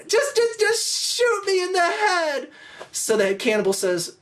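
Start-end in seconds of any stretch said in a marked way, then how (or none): 0.1-2.5 very high, hammed-up pleading wail; stammer, then drag it out in mock tears; scared-baby crying. Fred begs the cannibals for a quick death, mock crying. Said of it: "freaking out and crying like a little".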